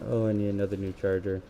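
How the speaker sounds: ordinary delivery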